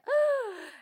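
sharp high mock gasp; dramatic gasp. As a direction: gasp like you're scandalized. Dramatic gasp before flirty quote.

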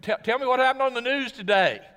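loud, high, pushy grown-up voice; hammed-up order to a two-year-old; asking a toddler to report the news, overdone; mock demand to a toddler. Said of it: "you can't say to a two-year-old".

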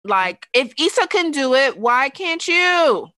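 loud, emphatic yell. Real, not acted. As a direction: shout the question out. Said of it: "I'm sorry to yell in your ears".

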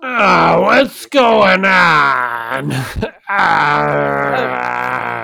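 put-on loud wailing kid; crying child voice.

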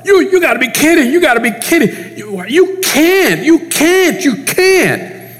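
frustrated protest. Retold or imitated. Peter protesting to Jesus, high and exasperated. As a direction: protest like a friend who can't accept it. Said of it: "extremely frustrated and extremely upset".

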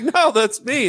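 high insistent no; high protest. Pretend. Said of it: "he was like".

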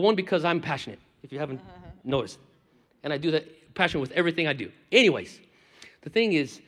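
ordinary talk, nothing marked

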